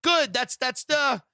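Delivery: high, loud, fast gamer urgency; mock frantic player; playing Mario. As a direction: narrate a video game level like you're mid-jump. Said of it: "they're like Mario".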